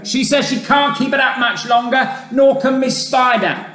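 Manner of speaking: high, loud call from below; urgent high-pitched yell. A narrator's reading. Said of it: "yelled the centipede from below".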